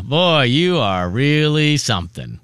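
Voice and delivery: slow, drawn-out ambiguous praise; dragged-out "really something", loaded with sarcasm; backhanded compliment. Retold or imitated. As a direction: stretch out the praise so it sounds doubtful. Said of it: "you can read the tone".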